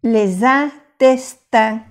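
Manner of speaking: very slow "Les intestins" with liaison and nasal ending; careful slow French demo; slow nasal vowels. Shown as a demonstration. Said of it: "Acuérdese de las vocales nasales".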